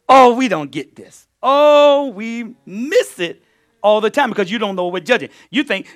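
usual speaking voice

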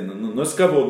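ordinary talk, nothing marked

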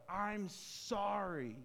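imitating a boy's long yelled apology; mock yell. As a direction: cry it out slowly and high, without full volume. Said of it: "he just yells out".

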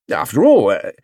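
starts an argument and tails into "uh"; hesitant. A narrator's reading. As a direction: begin "After all", then falter. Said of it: "Mister Brown hesitated".

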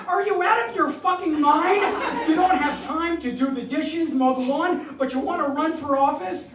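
high, loud, incredulous wife's tirade; acted angry scolding. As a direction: imitate an exasperated wife yelling at her husband. Wife scolds him, acted quote.

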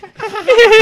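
high, loud witch cackle; witch laugh. Retold or imitated. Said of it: "little flipping witch laugh".

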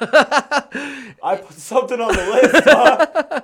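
high, loud, slow laughing mockery. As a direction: squeal it through laughter. Laughing mock of himself.

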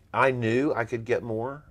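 ordinary delivery

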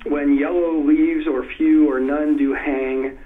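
slow iambic reading, every second syllable hit; emphasized meter. Shown as a demonstration. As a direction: lean on each stressed syllable, slowly. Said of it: "I emphasize it a little more".

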